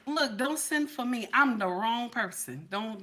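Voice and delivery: normal talking voice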